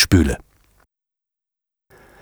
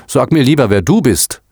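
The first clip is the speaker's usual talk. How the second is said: mildly indignant retort, pitched up; 6 semitones higher than usual. A narrator's reading. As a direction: answer back, a touch affronted. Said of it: "entgegnete er entrüstet". (leicht entrüstet erwidert)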